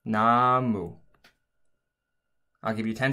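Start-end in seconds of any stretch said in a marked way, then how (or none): 0.1-1.0 나무 read as Korean namu; Korean word. Shown as a demonstration. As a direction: say 나무 clearly in Korean. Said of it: "The fourth word I would like you to try is".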